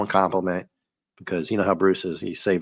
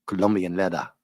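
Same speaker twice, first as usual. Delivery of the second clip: put-on Ricardo Montalban accent. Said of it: "Can you imagine with that accent?".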